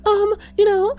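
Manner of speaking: high mock woman voice; mock high-pitched lady. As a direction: squeak it like a prissy lady. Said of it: "she's all like".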